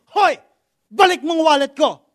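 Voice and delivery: loud high demand to give the wallet back; angry yell. Retold or imitated. Small lady yelling at the thief who stole her wallet, loud and angry. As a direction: shout at the thief to return it.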